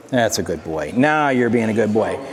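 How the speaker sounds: calming praise. Real, not acted. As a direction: drop the voice low and praise him calmly. Praising the dog as it settles.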